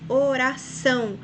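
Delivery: oração said slowly, o-ra-ÇÃO, weight on the final ção; stress on last syllable. Shown as a demonstration. Teacher showing pupils the strongest syllable, oração stressed on ção. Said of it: "são é a sílaba mais forte".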